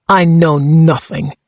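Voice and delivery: put-on slow, heavy Czech accent; stubborn denial in a thick Czech accent. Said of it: "his accent so thick".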